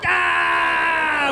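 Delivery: high, drawn-out mock yell; imitated war cry. Pursuer pulls alongside the teens' car, imitated war cry. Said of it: "he gives us one of these".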